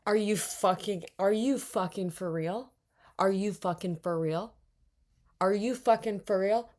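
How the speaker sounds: loud, disbelieving rant at the screen; exasperated outburst. Real, not acted. Outraged at the park deficit.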